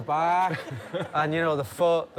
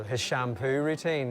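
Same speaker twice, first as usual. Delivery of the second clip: ordinary delivery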